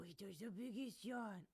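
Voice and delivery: ordinary delivery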